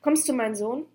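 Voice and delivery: called out. A narrator's reading. Mother calling her son to her, called out. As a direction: call out with a lifted final note. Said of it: "rief sie".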